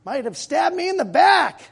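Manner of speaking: shouted high and loud about betrayal; preaching shout. Real, not acted. Preacher to congregation about hurtful people, shouting. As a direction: cry it out like a wounded preacher.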